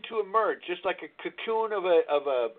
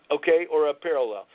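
ordinary talk, nothing marked

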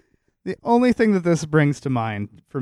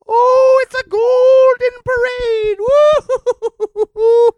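high, loud delighted squeal; gleeful squeal; 7 dB louder than usual. Retold or imitated. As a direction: cheer it like a giddy child. Gleeful Trump parade fantasy.

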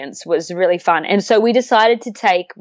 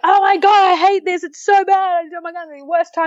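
much higher, hammed-up whining about the uphill; mock misery; 10 semitones higher than usual. Hiker on the uphill, hammed-up high moaning complaint. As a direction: moan like the climb is killing you.

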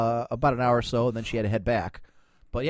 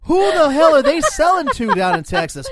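loud, higher outraged rant; indignant; 11 semitones higher, 8 dB louder than usual. Real, not acted. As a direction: blow up in disbelief. Indignant rant about Texas sellers.

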